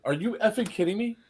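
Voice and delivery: high-pitched incredulous question; retold indignation. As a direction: imitate an affronted woman, pitch climbing. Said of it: "She goes".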